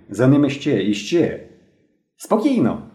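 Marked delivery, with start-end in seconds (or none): 2.2-2.8 put-on high-pitched boyish call; shouted order. A narrator's reading. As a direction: call it out high, without real loudness. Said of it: "кричав білобрисий".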